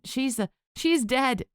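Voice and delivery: slow, high, cutting retort; snapped. Acted. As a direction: bite the words back at her. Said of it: "Erica snapped back".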